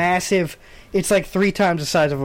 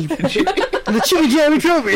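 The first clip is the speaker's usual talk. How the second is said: laughing; 9 semitones higher, slower than usual. Real, not acted. Joking made-up trophy name.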